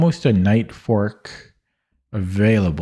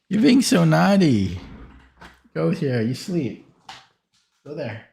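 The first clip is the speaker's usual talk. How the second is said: high, playful scolding of a naughty dog; high teasing pet talk, back at the mic; 7 semitones higher than usual. Real, not acted. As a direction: tease the puppy in a lifted voice.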